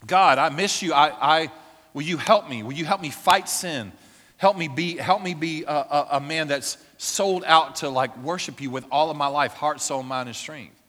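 loud, fervent battle prayer; warring prayer. Retold or imitated. Pastor retelling his nighttime warring prayer, loud and fervent. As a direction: shout your prayer like a warrior. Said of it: "I prayed and I warred".

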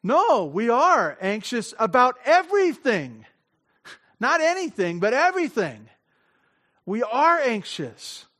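loud, high "everything" stressed; emphatic protest. Retold or imitated. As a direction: protest that you worry about all of it. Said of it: "their response initially was this".

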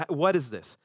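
usual speaking voice

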